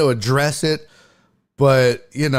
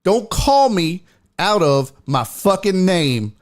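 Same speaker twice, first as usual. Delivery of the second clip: slow, higher, emphatic telling-off; stern warning; 5 semitones higher, slower than usual. Retold or imitated. Comic warning heckler Craig off, stern and deliberate. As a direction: spell out each word as a final warning.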